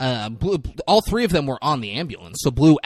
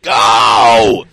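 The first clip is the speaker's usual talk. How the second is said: huge, drawn-out low bellow; hammed-up roar; 4 semitones lower, 12 dB louder, much slower than usual. Man opens the back of the ambulance, bellowing at them. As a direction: roar the order with full force.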